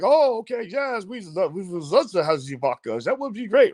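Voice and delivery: hammed-up German accent with swooping pitch. German scientists accepting the vodka party, put-on German accent. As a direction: imitate an agreeable German scientist in a mock accent. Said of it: "in their German accent".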